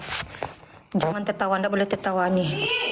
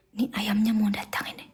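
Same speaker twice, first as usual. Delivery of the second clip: whisper. Real, not acted. Hushed asides awaiting delivery.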